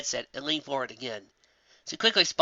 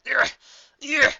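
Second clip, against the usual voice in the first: high, loud pulling grunts; straining grunts; 13 semitones higher, 5 dB louder than usual. Acted. Applejack grunts tugging Fluttershy's tail. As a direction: grunt while pulling hard. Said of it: "she grunted out between pulls".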